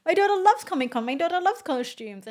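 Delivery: high, quick mock enthusiasm; imitated gushing parent. Driver telling passengers about the daughter, imitated gushing.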